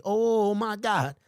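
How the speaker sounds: ordinary delivery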